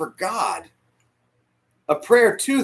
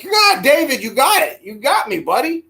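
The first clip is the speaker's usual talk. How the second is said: excited cry to God; 7 semitones higher, 6 dB louder than usual. Retold or imitated. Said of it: "I'm like".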